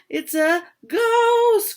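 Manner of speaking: high, loud and slow, drawn out in terror; loud terrified wail; frightened cry. Acted. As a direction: cry out in fear at a ghost. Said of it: "cried Daisy".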